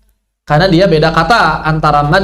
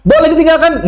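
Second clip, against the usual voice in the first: mock hasty ruling; 10 semitones higher than usual.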